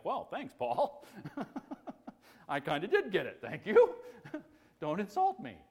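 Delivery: high-pitched playful indignation, breaking into laughs; mock offended. Preacher answering Paul back over the insulting verse, mock offended. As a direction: protest in jest through laughter.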